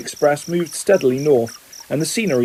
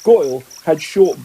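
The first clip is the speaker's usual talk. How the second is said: plain reading voice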